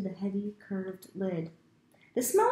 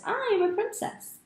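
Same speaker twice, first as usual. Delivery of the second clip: even higher, ending on a rise; rising excited girl; 14 semitones higher, 3 dB louder than usual. Acted. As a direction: call it again, climbing higher.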